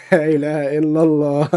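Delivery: slow, laughing exclamation; amused disbelief, laughing. Real, not acted. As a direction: chuckle through the words. Laughing at edited photo.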